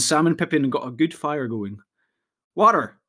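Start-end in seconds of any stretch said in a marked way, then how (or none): 2.6-3.0 higher, louder cry for water; shout. A narrator's reading. Pippin shouts to the others for water, loud. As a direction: call out for water. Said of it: "shouted Pippin".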